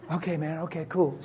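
low, soft bedtime reply; hushed and settling down; quiet agreement. Retold or imitated. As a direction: murmur a sleepy-time okay.

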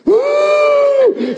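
high startled gasp. Retold or imitated. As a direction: let out a shocked high cry. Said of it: "I went".

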